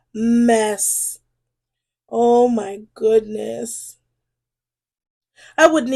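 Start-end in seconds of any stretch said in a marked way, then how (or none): none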